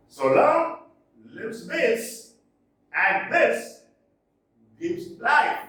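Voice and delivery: slow, stressed reading of a verse line; teacher reciting Shakespeare with marked rhythm; poem recital. Shown as a demonstration. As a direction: read it out like a sonnet, beat by beat. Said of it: "iambic pentameter".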